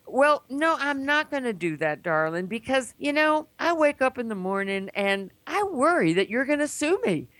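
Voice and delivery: high, wheedling Southern voice; Southern accent. Retold or imitated. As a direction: refuse sweetly in a put-on Southern accent. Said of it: "It's got a Southern accent".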